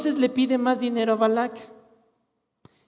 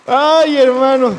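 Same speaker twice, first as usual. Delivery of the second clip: loud exclamation; 2 semitones higher, 9 dB louder, slower than usual. Real, not acted.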